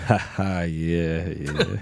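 soft, low chuckling laugh; lightly voiced amused sidekick; Branford laughing. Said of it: "he'd just be like this".

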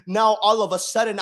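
ordinary talk, nothing marked

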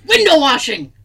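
loud, high, proud blurt of the answer. Retold or imitated. Contestant proudly answering the quiz host, loud blurt. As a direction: announce it like a brilliant idea. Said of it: "And he goes".

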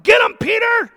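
yells it high and loud like a fan at a fight; cheering shout. Retold or imitated. Preacher cheering Peter on as he reads, a loud high shout. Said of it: "I'm like".

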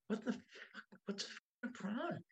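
as if asking yourself under your breath; hushed mock incredulity; retold bafflement. Inner reaction in retold scene.

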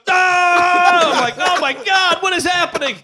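high loud panicked shout; mock panic. Driver panicking as the guy's hand is run over. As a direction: freak out in disbelief.

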